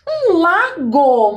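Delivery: teacher slowly revealing the answer. Shown as a demonstration.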